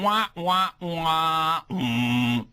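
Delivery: drawn-out strangled wah-wah trailing into a hum; strangled noise. Retold or imitated. Critic mimicking the movie's noise, strangled. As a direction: imitate a choked wail, ending on "mm". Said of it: "That's a strangled noise".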